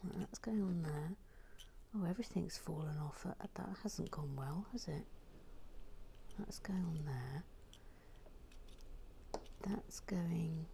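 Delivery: soft whispered muttering. Real, not acted. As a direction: whisper it under the breath.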